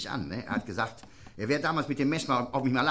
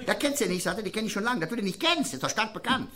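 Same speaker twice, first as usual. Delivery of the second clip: lightly raised tinny pub owner; a touch higher, tinny and insistent; high Lower Rhine voice; 9 semitones higher than usual. Retold or imitated. Barkeeper insisting to his friend, in a high tinny voice. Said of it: "so ganz hoch und Metallen sprechen".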